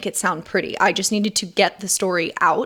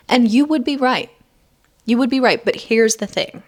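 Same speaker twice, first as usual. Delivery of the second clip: ordinary talk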